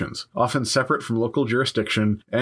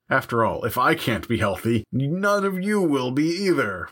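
mad scientist; 3 semitones higher than usual. Pretend. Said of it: "is what the lepers were supposed to be saying".

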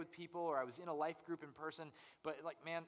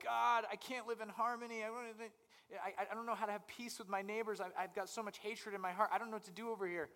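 higher, pleading voice of a troubled believer; imitated struggling believer; 7 semitones higher than usual. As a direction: play someone overwhelmed, begging God. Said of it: "we're like".

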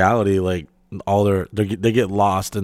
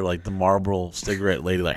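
usual speaking voice

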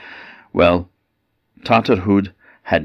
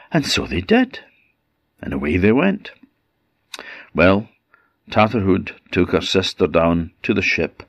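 plain reading voice

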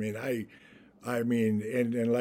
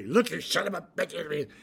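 mock threat; 5 semitones higher than usual. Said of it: "Yaphet grabs me".

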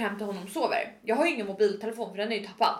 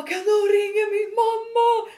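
retold scream; 10 semitones higher, 6 dB louder than usual. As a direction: re-enact wailing to a crowd for mum. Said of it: "Så jag bara skriker". (utdraget, gällt skrik, återberättat)

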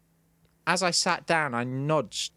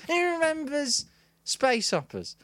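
high-pitched mock stand-up question; observational comic; 12 semitones higher than usual. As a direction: parody an amateur working the crowd. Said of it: "trying to do observational comedy".

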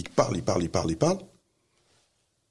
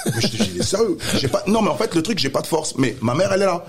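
high, laughing disbelief; laughing while telling; 11 semitones higher, 5 dB louder than usual. Real, not acted. Telling a friend the story, laughing high and loud. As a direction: tell it through real laughter. Laughing retelling his helplessness.

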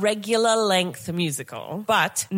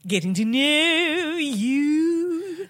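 announcer voice; 6 semitones higher, much slower than usual. Acted. Segment title announced slowly.

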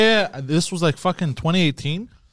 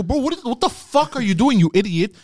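retold yell; 6 semitones higher than usual. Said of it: "I yelled at the manager".

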